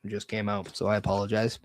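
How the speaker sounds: normal talking voice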